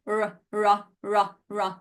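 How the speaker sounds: rolled Italian r, ra, ra; tapped Italian r sound. Shown as a demonstration. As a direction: trill the tongue on ra, ra. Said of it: "So think about opera".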